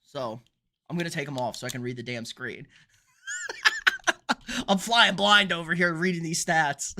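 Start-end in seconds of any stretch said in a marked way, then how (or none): 3.3-4.6 short high laugh that falls away; real laugh. Real, not acted. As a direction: laugh at your own trouble. Laughs at stupid sunglasses.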